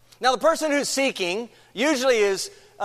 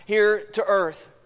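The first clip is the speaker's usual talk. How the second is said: opening prayer; 4 semitones lower than usual. Acted. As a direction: pray humbly before the congregation. Pastor leads an opening prayer.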